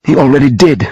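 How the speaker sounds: a touch of hurt bitterness; bitter. A narrator's reading. Sophie feeling sold out by Sam, bitter. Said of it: "she said bitterly".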